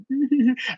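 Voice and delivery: high, soft mock giggle; imitated laughing. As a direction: mimic his helpless tittering. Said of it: "he's laughing like".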